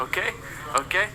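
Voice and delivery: French accent. Real, not acted. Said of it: "You just said okay in a French accent".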